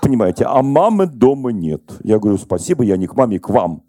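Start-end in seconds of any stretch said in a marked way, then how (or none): none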